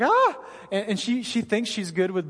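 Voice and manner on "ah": sharp, high, loud yelp of pain; startled cry. Retold or imitated. Wife cutting her hand on glass, sharp startled yelp. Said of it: "and she's like".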